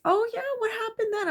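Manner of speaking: put-on very high-pitched child voice; squeaky, put-on eager kiddie voice; amused kids. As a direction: imitate kids eager for the funny story. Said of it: "They thought it was funny".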